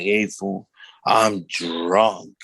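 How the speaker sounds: imitating a beaten, drunk brother; defeated. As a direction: drop it low and drag out the admission. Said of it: "he was, like, defeated".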